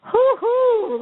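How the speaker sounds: high, loud "hoo-hoo"; imitated hooting call. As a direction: call out a high-pitched hoot. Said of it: "I remember hearing something go".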